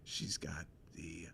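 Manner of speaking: low, soft voice sinking toward a whisper; confidential, slowing down at the end; hushed confiding mentor in a scene. Acted. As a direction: play a mentor quietly sharing a secret with a kid.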